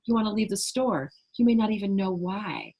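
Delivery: normal talking voice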